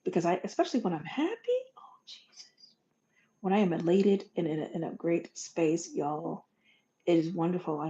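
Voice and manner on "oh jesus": whispered "oh, Jesus" under the breath; whispered aside. Real, not acted. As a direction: let it slip out in a whisper. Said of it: "whispered aside mid-story".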